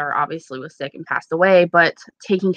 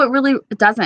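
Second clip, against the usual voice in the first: usual speaking voice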